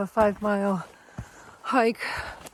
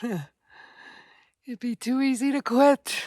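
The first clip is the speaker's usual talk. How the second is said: slow, higher, sighing resignation; weary sigh; 4 semitones higher, 4 dB louder, much slower than usual. Real, not acted. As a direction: sigh it out slowly, worn down. Sighing reflection on not bailing.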